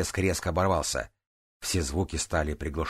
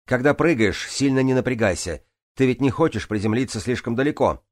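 higher, raised-voice instruction; calling out; 6 semitones higher, 4 dB louder than usual. A narrator's reading. Swimmer advises Джанель before her jump, calling out. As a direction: call advice up to someone.

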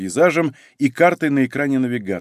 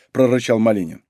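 ordinary delivery

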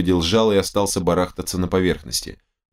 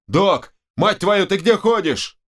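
high, alarmed calling out for the medic; urgent yell; 12 semitones higher, slower than usual. Acted. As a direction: yell for the doc, high and impatient. Friend shouts for the medic. (встревоженный, нетерпеливый окрик)

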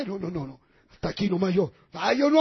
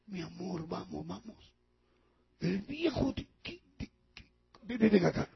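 put-on old man's coaxing whisper; 3 semitones lower, 9 dB quieter than usual.

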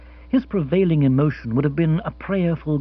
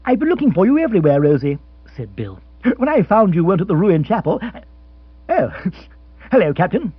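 fast, louder, excited chatter; eager rush; 3 semitones higher, 5 dB louder, faster than usual. Acted. Bill bursts in breathlessly looking for Rosie, fast and excited. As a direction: tumble the words out in a hurry.

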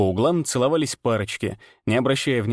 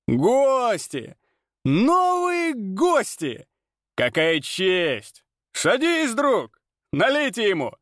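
high, booming fairground barker; loud carnival barker; 10 semitones higher, much slower than usual. Acted. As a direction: hawk it to the whole tavern. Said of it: "как у ярмарочного зазывалы".

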